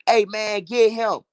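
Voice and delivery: imitating the dudes shouting get him; voicing the dudes. Dudes spotting the robber, mock call to get him. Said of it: "the dudes say".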